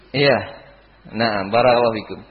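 ordinary delivery

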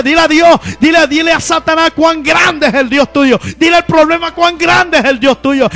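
preaching at full force. Acted. Preacher rousing the congregation about finances, full-force preaching.

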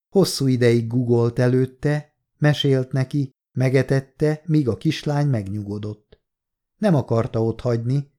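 plain reading voice

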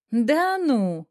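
loud, rising-falling astonished exclamation; surprised gasp. A narrator's reading. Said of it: "удивлённо охнула её сестра". (удивлённое оханье)

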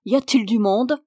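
calling out. A narrator's reading.

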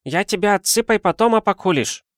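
put-on high, broken-Russian boy's voice; a young street boy chattering up high; Chinese boy. Chinese boy offers opium.

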